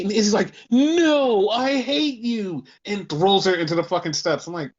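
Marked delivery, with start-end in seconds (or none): 0.7-2.7 high loud "No" then slow drawn-out hate; mock villain rejection. Izzy turning on Tatum, slow mock hateful rejection. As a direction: sneer it like a melodrama villain.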